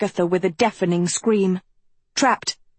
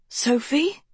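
croaked; 5 semitones higher than usual. A narrator's reading. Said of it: "she croaked".

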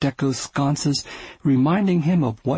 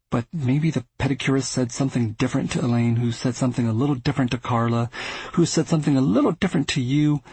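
fast, rattled-off rebuttal; faster than usual. Acted. Arthur rebutting gossip chain.

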